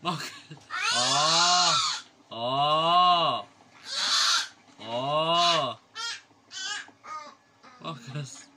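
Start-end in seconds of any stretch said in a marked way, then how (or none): 0.9-5.8 long, loud open scream. Real, not acted. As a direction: let out a full loud scream. Screaming over the noise.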